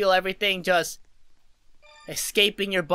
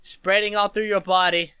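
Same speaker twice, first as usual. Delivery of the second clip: slow breathing-coach voice; guided meditation; slower than usual. Acted. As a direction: guide their breath, calmly. Leads a breathing exercise.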